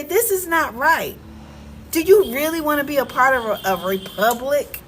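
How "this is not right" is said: firm declaration. Shown as a demonstration. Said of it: "Stand up for yourself and say".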